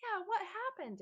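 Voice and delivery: high, insistent question; demanding. A narrator's reading. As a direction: demand an explanation. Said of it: "Antbite demanded".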